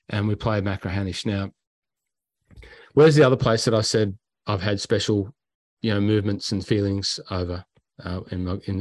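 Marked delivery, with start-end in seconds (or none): none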